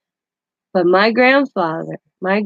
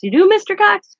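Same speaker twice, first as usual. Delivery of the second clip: a high, lightly voiced surprised doctor; mock-surprised doctor, pitched up; imitating the doctor; 13 semitones higher, 5 dB louder than usual. Doctor voiced in retold story.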